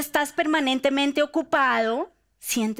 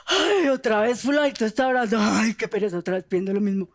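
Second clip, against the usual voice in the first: weary, louder complaining; bored sigh; 4 dB louder, faster than usual. Pretend. Imagined God tired of the same prayers, bored. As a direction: sound fed up. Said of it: "nos imaginamos a Dios".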